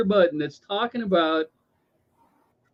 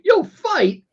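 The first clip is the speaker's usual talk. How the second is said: higher, loud scoffing question: You'll fight?; mocking disbelief; 11 semitones higher, 4 dB louder than usual. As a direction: sneer at the claim "You'll fight?". Mocking people who won't even vote.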